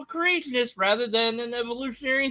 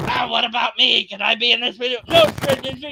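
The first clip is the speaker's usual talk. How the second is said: normal talking voice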